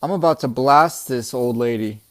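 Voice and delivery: lightly played Western standoff warning; old Western style. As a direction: play at holding a hostage at gunpoint. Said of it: "old Western style says".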